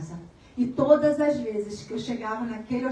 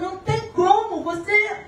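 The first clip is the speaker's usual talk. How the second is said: higher, louder, rising into a sung prayer cadence; fervent preaching; 7 semitones higher, 4 dB louder than usual. Acted. Testifying to the congregation, lifted into fervent preaching. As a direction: let the voice climb in praise.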